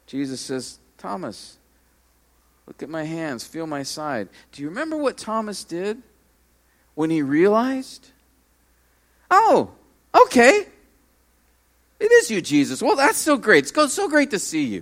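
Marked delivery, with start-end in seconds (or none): 1.0-4.3 soft, tender invitation; hushed, loving voice of Jesus; gentle Jesus. Retold or imitated. 9.3-14.8 high, breezy, offhand greeting; a lightly voiced shallow reply; mock casual Thomas.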